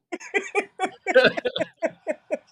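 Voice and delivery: high-pitched burst of laughter; giggly high laugh. Real, not acted. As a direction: crack up high. Cracks up at the story.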